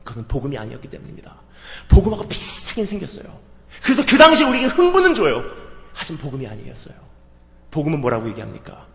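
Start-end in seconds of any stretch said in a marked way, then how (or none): 3.8-5.4 loud, high, urgent sermon voice; full-force preacher's cry; preaching at full force. Acted. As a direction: drive it home to the congregation.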